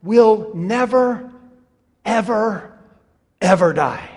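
"never, ever, ever" slow and pounded; emphatic slow build. Shown as a demonstration. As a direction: stretch out each "ever" with pauses. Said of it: "this could easily be read".

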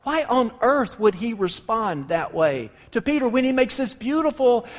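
ordinary talk

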